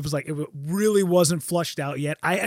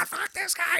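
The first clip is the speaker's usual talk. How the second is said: freaking out; 12 semitones higher, 6 dB quieter than usual. Retold or imitated. Retold freak-out at the doctor.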